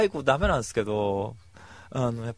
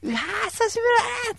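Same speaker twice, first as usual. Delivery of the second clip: much higher, fast squeal of hello; giddy greeting; 4 dB louder, faster than usual. Retold or imitated. Him greeting his crush in a thrilled squeal. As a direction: gush at seeing her. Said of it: "つって言って".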